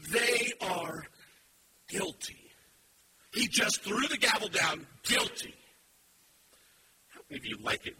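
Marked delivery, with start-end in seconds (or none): 0.1-5.5 loud, rising preacher's call; fiery sermon voice; full-force preaching. Acted. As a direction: drive it home to the congregation.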